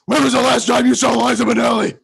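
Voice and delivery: shout. Acted. Hero shouting at Scorsese for Liza's whereabouts. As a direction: bellow the question at him.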